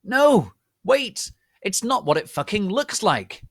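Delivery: high, loud desperate yell; shout. A narrator's reading. Draco begging her to wait, desperate shout. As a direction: plead at full volume. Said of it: "he shouted".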